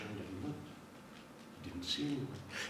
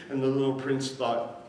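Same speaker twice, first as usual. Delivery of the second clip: ordinary delivery, nothing marked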